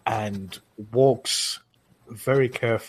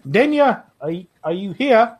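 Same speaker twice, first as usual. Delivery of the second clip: guard calls up louder, pitched high; calling upstairs; 11 semitones higher, 5 dB louder than usual. Acted. Guard calls up to the steward, loud and high. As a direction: call her name up the stairs. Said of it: "he looks up and he goes".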